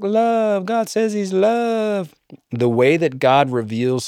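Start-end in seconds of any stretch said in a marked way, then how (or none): 0.0-2.1 high, slow, mocking sing-song; lightly lampoon the listener who only sees love; hammed-up high voice drawing out love; mock sweet believer. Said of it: "Some of you are like".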